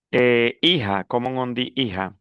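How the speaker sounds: normal talking voice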